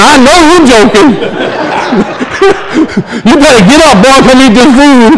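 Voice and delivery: wife scoffing. Retold or imitated. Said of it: "She went".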